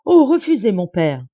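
high, loud, urgent plea; crying out. A narrator's reading. Daughter begs her father to refuse, crying out. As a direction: cry out to stop him. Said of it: "s'écria Marianne".

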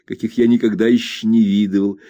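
ordinary delivery, nothing marked

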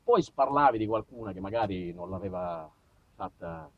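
ordinary delivery

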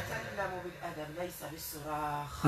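distant, hushed possessed voice; thick strong voice. Real, not acted. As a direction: murmur it like a spirit speaking through her. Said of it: "بصوت غليظ قوي".

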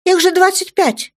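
put-on a girl's shocked indignant cry. A narrator's reading. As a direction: protest it, outraged. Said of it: "возмутилась Даша".

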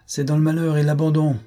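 high, declaiming sardonic rogue; swaggering intruder. A narrator's reading. Ragged intruder addressing the stunned marquise, sardonic declamation. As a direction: declaim it like a bold, mocking rogue.

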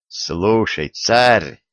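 ordinary delivery

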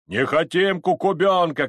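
high, loud crowd protest; loud cry of refusal; shouted objection. Acted. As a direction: yell down the rival candidate. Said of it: "кричала другая".

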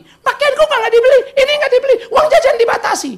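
put-on very high, loud childish whine; whining teenager. Teen grumbling to parents about pocket money, high whine. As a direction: complain like a sulky kid.

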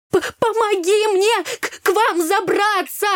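high, stuttering plea for help; panicked stammer. A narrator's reading. Girl scared by a snake begs into the boat, stammering. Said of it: "заикаясь, быстро проговорила я".

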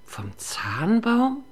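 whispered, slow disbelieving echo; horrified whisper. Acted. Mother echoing her son's words in disbelieving horror, whispered. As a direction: breathe it out in horror. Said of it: "in ungläubigem Entsetzen nach".

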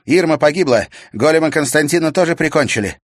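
high, loud and fast; breathless urgent report; urgent young witch. A narrator's reading. (взволнованный доклад ведьмы)

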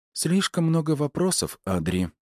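light, high character voice for a mother; mother deflecting questions. A narrator's reading. Mother gently deflects son's questions.

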